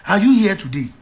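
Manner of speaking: ordinary talk, nothing marked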